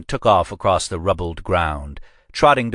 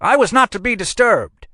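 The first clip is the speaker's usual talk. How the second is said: much higher, loud indignant outburst; furious at being barged in on; crying out; 5 dB louder than usual. A narrator's reading. Old examiner yells at the intruders, high and loud.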